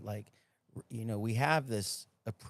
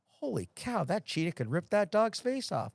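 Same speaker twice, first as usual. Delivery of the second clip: higher-pitched, mock-amazed inner voice; retold astonishment; 8 semitones higher than usual. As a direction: act out your disbelief at the sight. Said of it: "I'm thinking to myself".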